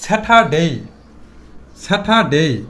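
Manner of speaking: Saturday with the r left silent, an open "ah". Shown as a demonstration. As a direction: drop the r in Saturday and lengthen the final "day". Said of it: "এখানে সাইলেন্টটা কি আছে". (Saturday-তে r নীরব, "স্যাঠা-ডেই")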